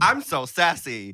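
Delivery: high, drawn-out showy sass; slow it down, parodying forced sassiness; mock sassy. Mocking queens round the morning table, slow high sass. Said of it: "everyone's trying to be like".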